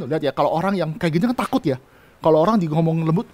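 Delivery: ordinary delivery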